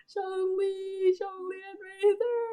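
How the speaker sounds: restrained reenacted squeal; happy squealing. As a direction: draw the names out high. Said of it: "I'm so happy".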